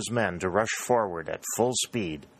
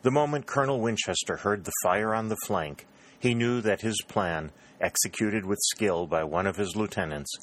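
usual speaking voice

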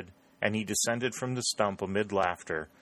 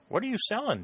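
curious question; 13 semitones higher, faster than usual. A narrator's reading. Prescott asks curiously.

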